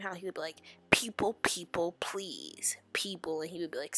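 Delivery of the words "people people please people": a light take on the spluttering teacher, popping every P; spitting teacher impression. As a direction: imitate the That's So Raven teacher spitting out his P's. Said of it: "I sound like that teacher off of, uh, That's So Raven".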